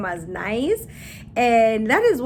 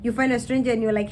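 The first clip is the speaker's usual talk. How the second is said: usual speaking voice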